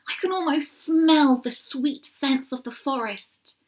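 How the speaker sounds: a touch of joyful longing; happy. A narrator's reading. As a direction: savour the thought of home. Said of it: "he told her happily".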